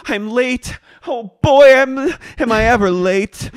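very high, loud, comic fluster; hammed-up flustered arrival. Waitress charging in late for shift, hammed-up fluster. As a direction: burst in shrieking comically. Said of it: "Raquel comes charging in".

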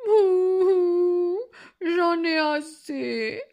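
loud, high theatrical bawling; sobbing wolf. A narrator's reading. Wolf complains to grandmother, bawling between sobs. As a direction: wail it out slowly between hiccups. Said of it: "répondit le loup entre deux hoquets".

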